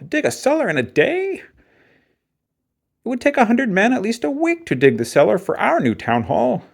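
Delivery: a town official who can't believe his ears; incredulous selectman. Acted. Selectman doubts one-day dig.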